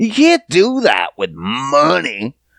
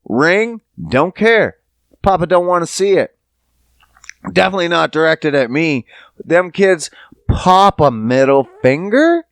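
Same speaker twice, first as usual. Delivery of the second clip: ordinary talk, nothing marked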